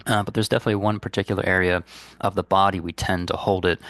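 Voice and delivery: ordinary talk, nothing marked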